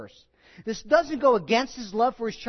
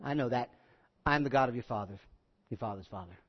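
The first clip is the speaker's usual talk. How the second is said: quiet reply; 7 semitones lower, 6 dB quieter, faster than usual. Acted. Jacob's reply, then soft God.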